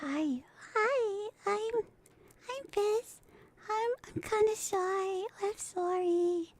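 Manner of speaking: high, soft, timid little voice; bashful girl apologising quietly; shy girl voice. Shown as a demonstration. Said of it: "I could be like, uh, shy".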